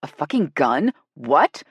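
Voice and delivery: loud, startled outburst; practically yelling. A narrator's reading. Celine shocked over the phone, near yell. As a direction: nearly yell it in disbelief. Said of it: "Celine practically yelled".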